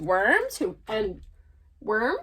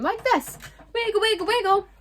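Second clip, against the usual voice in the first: playful higher wiggle voice; 9 semitones higher, faster than usual. Acted. Worm shows wiggling playfully.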